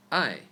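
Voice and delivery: I falling sharply, fourth tone. Shown as a demonstration. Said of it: "For tones".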